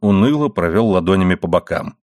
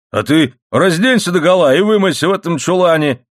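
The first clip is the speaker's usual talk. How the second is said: lightly voiced husband, higher-pitched retort; bickering husband; 8 semitones higher, 3 dB louder than usual. A narrator's reading. Husband challenging wife to wash in the scullery, indignant.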